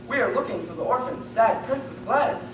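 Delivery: normal talking voice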